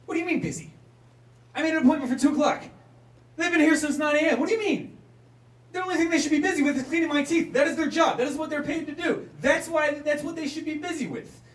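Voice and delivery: high, loud, fast indignant yelling; outraged rant. Retold or imitated. Outraged at receptionist.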